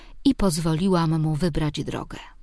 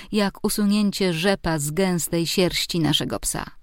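ordinary delivery

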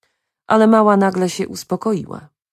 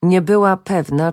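ordinary delivery, nothing marked

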